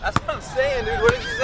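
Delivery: high shout over the gusts; shouting in a hurricane. Real, not acted. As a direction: yell to be heard over wind. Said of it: "I can't hear you over this wind".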